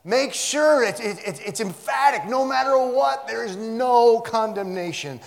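high, very loud preaching outburst; emphatic. Real, not acted. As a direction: drive it home at full voice. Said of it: "That's what I meant by emphatic".

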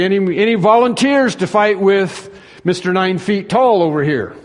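high, loud call to the ranks; calling for volunteers. Retold or imitated. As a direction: shout it out over a crowd. Officers calling armies for volunteers.

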